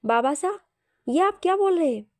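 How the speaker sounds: astonished question, pitch jumping up; taken aback, voice lifting; surprised. A narrator's reading. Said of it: "मान हैरानी से बोला".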